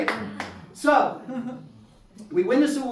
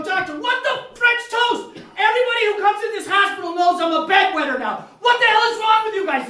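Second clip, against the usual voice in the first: high, loud, exasperated yelling; outraged rant; 13 semitones higher, 8 dB louder than usual. Retold or imitated. Patient yelling at the doctor over the bed sign, loud outraged rant. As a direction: blow up at them in mock fury. Ranting at hospital staff.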